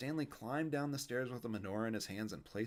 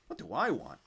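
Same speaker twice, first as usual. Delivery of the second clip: louder flustered sputter; a boy tripping over his words; flustered child echoing the question; 4 semitones higher, 8 dB louder than usual. A narrator's reading. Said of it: "Stanley sputtered".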